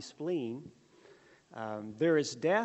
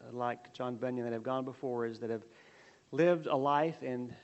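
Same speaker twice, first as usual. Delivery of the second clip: prayer; 3 semitones lower than usual. Acted. Opening prayer before the lesson.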